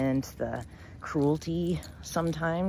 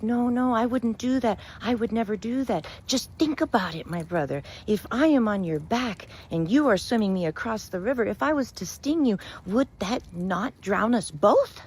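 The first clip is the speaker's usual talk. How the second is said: much higher, pleading insistence; scorpion protesting; 7 semitones higher than usual. Acted. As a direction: protest innocence, sweet and persuasive. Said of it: "but the scorpion cried".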